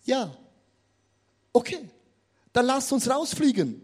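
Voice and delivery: ordinary talk, nothing marked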